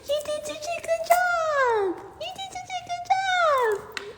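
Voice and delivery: very high, slow squeaky praise. Real, not acted. Owner coos praise at her puppy, very high and slow.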